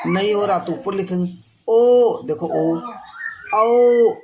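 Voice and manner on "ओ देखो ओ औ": ओ and औ drawn slow and louder; loud rounded vowel demo. Shown as a demonstration. Teacher shows rounded lips on ओ, loud and slow. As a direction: round the lips and hold ओ, then औ. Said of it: "ओठ पे focus कीजिए".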